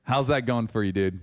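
a hint of teasing, unbothered God; casual God voice. God answering the pastor, lightly voiced laid-back teasing. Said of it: "And God's like".

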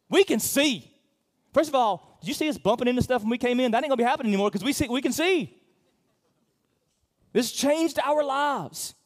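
high, loud and fast, bursting with joy; healed blind men boasting to Jesus, excited shouting. Acted. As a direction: play the healed men overjoyed.

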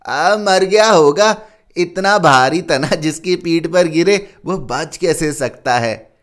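loud, high, slow peon. A narrator's reading. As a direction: dismiss him loudly. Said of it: "दूसरा चपरासी बोला".

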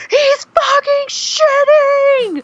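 very high, slow, stretched shout; panicked shriek. Retold or imitated. Mom yelling back at her husband, shrieking. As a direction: shriek it at the top of your voice. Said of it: "And I'm like".

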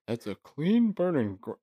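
a hint of Hank Hill, abandoned; failed voice attempt. Shown as a demonstration. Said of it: "See, I can't do it".

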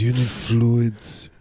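slow, heavy mock German accent. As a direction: drag out you need fluids like an old German man. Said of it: "That's my German accent".

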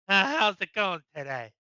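drawn-out fake-friendly dodge; slow, mocking chit-chat; mock politician small talk. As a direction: imitate an evasive politician making small talk. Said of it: "the government says".

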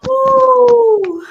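high loud whoop of feeling; emotional whoop. Real, not acted. Emotional release after retelling.

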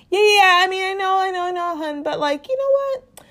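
high, hurried mock reassurance; placating husband. Voices husband talking wife round.

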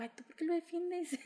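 her own retort replayed, high and whiny; mock complaint. Daughter teasing mom over defending Leo, high whiny mock. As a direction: pitch up and whine at mom. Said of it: "le digo".

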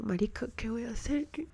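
soft, helpless whisper; hushed, voiceless panic; panicked whisper. Retold or imitated. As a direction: whisper it like you're trapped in your own body. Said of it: "entonces yo como".

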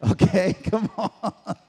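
slow words breaking into real laughter; drawn-out, laughing exhortation. Real, not acted. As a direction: let a long laugh take over. Preacher breaks into long laughter.

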